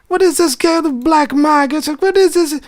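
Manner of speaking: high, loud, put-on disgusted voice; exasperated English version. Shown as a demonstration. Demo of the valve release sound in English, high and loud. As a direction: hurl it out like a fed-up stranger. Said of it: "I wanna make the valve release sound in English as well".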